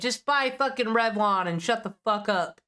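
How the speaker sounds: normal talking voice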